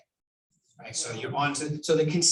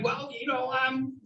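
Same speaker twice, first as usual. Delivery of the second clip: mock skeptical seller; 8 semitones higher than usual. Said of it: "to me, it's like".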